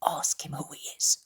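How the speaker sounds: whispered aside; hushed, whispered request. A narrator's reading. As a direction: whisper it to a neighbour. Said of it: "he whispers to him".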